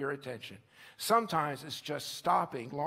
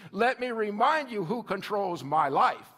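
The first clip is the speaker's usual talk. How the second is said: a touch of indignant pushback, louder; defiant retort; 3 semitones higher, 7 dB louder than usual. Acted. Hearer's reply when God asks control, defiant and louder. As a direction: snap back, guarding control. Defiant little Herod voiced.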